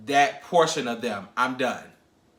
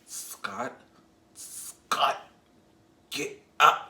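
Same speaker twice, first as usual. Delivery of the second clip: whisper; 4 dB quieter, much slower than usual. Retold or imitated. Friend waking drunk Scott.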